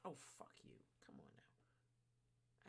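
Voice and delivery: whispered frustration under the breath; frustrated whisper. Real, not acted. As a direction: hiss it to yourself in frustration. Muttering frustrated at chat.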